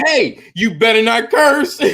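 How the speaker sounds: loud, high warning with laughter; mock scolding. Player warning twelve-year-old not to curse, mock-stern shout. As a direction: warn loudly, cracking up at the end.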